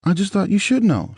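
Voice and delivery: high, hesitant woman's voice, stammering. A narrator's reading. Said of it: "she said, stammering".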